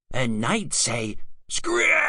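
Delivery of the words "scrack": very high squawk of a bird; budgie screech. Acted. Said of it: "Her was the budgig".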